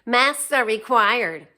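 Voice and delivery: loud, slow, clipped rule-citing; mock Karen lecture. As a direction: lecture like a self-appointed enforcer. Quoting the mask-scolding woman.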